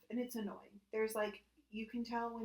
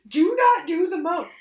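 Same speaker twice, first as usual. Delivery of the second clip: slow loud yelled command, higher; yelling; 9 semitones higher, 18 dB louder than usual. Retold or imitated. Roommate yelling at the AI, slow and loud. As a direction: shout it at the machine. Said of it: "he was yelling at it".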